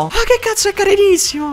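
high, excited squeal over something cute; squeal of delight. Real, not acted. Streamer spots a cute Pokémon, delighted high squeal. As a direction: gush like you just saw a puppy.

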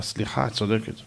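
humbled apology. Acted. Solomon to the ant, admitting she is right, very soft. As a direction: concede quietly, humbled.

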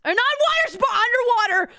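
high, loud correction; shouting. Real, not acted. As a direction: yell the fix.

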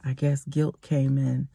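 ordinary delivery, nothing marked

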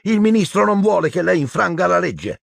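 high-pitched urgent protest; girl crying out. A narrator's reading. Hermione warning Umbridge it's illegal, high cry. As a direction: protest in a high, alarmed girl's voice. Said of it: "gridò ancora Hermione".

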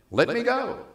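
urgent plea. Acted. Angel pleads at daybreak.

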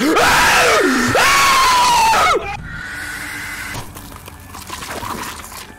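shrieking burst of laughter; wild shrieking laugh. Real, not acted. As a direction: howl with laughter at high pitch.

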